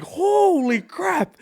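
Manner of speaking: high, slow, drawn-out holy crap; high, slow astonished exclamation; stunned surprise. Retold or imitated. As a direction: let the shock stretch the words out. Learns who the owner is.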